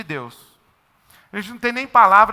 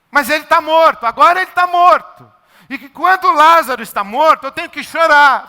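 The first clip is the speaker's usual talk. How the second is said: higher, louder, earnest answer; Jesus answering; 5 semitones higher, 4 dB louder than usual. Acted. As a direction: answer with a firm, rising voice. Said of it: "A resposta de Jesus seria".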